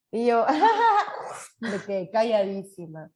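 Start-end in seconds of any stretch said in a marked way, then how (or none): 0.5-1.6 shocked squeal laugh. Real, not acted.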